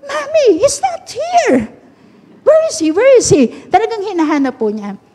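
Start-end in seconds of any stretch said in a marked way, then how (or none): 0.0-3.5 put-on high, searching little girl's voice; seven-year-old daughter. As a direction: ask like a puzzled small child looking for Jesus. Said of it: "Ang sagot po ng anak ko sa akin". (boses ng batang babae na nagtatanong)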